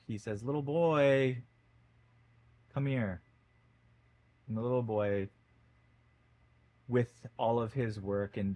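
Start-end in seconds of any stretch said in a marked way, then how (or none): 0.4-3.2 put-on a firm, mildly angry call to the boy; an old man calls the boy over, annoyed but still holding back. A narrator's reading. Old man calls the boy on the beach, mildly angry. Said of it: "in a fit of anger".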